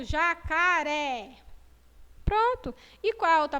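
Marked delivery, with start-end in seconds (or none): 0.0-1.4 Jacaré slow, open é stretched. Shown as a demonstration. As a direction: sound out Jacaré slowly. (Jacaré bem devagar, é aberto)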